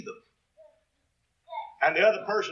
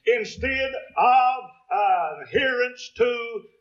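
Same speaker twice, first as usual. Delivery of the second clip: pulpit shout; 4 semitones higher than usual. Real, not acted. Preacher shouts the contrast.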